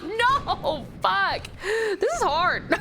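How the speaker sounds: high wail breaking into laughter; high, exasperated and giggling; laughing despair. Real, not acted. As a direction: protest helplessly through laughs. Losing in game, laughing.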